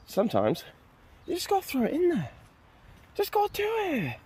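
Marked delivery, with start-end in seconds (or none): 1.3-4.2 very high, softer playful squeak; silly high voice. Pretend. Urging viewers to add game voices, silly high voice. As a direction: pitch it way up, light and silly.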